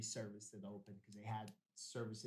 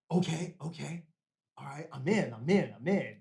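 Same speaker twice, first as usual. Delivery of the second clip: loud, insistent repeated yeses; eager agreement; 3 semitones higher, 11 dB louder than usual. Retold or imitated. Student accepting his teacher's offer, eager and loud. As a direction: act out jumping at the offer.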